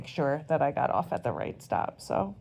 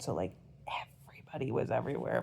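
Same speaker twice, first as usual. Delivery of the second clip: whisper; 5 semitones lower, 9 dB quieter, much faster than usual. Real, not acted. Hushed, fast aside.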